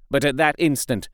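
plain reading voice

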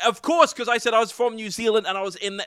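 high-pitched polite playing along; put-on high, agreeable voice; imitated Elijah Wood. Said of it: "and he was like".

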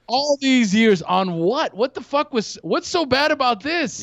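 high, incredulous protest; acted outrage at wasted years; indignant disbelief. Newly converted smoker to himself, indignant disbelief. Indignant realisation he was deprived.

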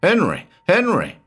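high urgent yelling of a name; shouted call. Acted. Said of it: "I shouted".

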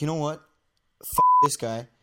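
loud burst of retold defiance; retold angry outburst. Storyteller replaying his reaction to a bully, angry outburst. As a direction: act out losing patience with him.

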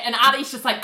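usual speaking voice

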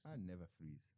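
low, soft, cool film quote. Acted. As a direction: quietly deliver it as a movie-hero impression.

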